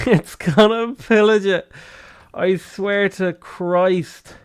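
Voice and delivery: high, drawn-out lament; stretched-out cry of dismay; anguished. Real, not acted. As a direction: wail it slowly in disbelief. Reacting to pillage in game.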